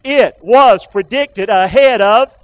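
slow, word by word, punched; call for repetition. Shown as a demonstration. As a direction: lead the crowd through each word. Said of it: "can you say that with me again?".

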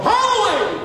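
cried out high, as if exclaimed; exclamation point. Shown as a demonstration. Preacher shows the congregation an exclamation mark, high exclamation. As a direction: exclaim it with a big leap in pitch. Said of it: "We put an exclamation point".

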